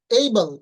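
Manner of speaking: "এইবল" loud, with ey glide first; correct pronunciation. Shown as a demonstration. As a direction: punch the ey of "এইবল". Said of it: "এটার উচ্চারণ হবে". ("এইবল" জোরে, এই দিয়ে শুরু)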